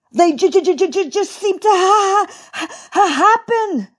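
high, helpless stutter; stammered protest. A narrator's reading. Poor bunny explaining his falls, high helpless stammer.

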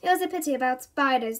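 ordinary delivery

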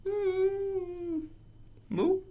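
very high, squeaky-small moo; high cow moo. Acted. Reader acting out cow language, very high moo.

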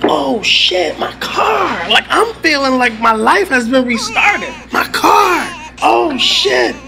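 high, cracking cry of relief; nearly weeping with joy over the car; finding his stolen car again; overjoyed disbelief. Retold or imitated.

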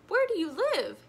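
lightly voiced teacher asking the class; Mister Ray asking. A narrator's reading. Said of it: "he asked".

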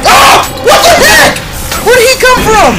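high, loud shocked cry; real startled yelling; startled shriek. Real, not acted. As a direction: yelp as it pops out of nowhere. Enemy appears suddenly.

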